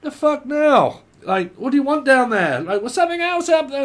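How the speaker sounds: loud, high-pitched mock annoyance; hammed-up irritated shout; exasperated outburst. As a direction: act fed up at being interrupted, loud and high. Acts irritated interruption.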